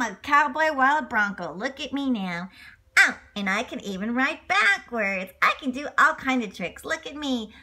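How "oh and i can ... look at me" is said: ordinary delivery, nothing marked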